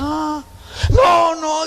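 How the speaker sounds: high, shouted mock refusal; hammed-up high-pitched objection; protesting daughter. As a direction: cry out like an offended daughter. Daughter acted in quarrel.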